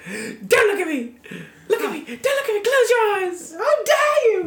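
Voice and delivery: high, hammed-up indignant outburst; Olivia Colman. Player mimicking the dying man's plea, mock-hysterical. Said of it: "Olivia Colman".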